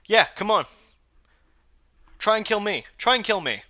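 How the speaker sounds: loud, high shouted dare; defiant taunt. Pretend. Daring the killer to his face, in a loud shouted taunt. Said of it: "I'd be like".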